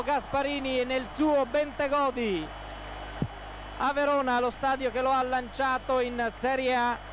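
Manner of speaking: breathless italian goal commentary. Real, not acted. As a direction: explode with joy at the goal. Goal scored, commentator erupts.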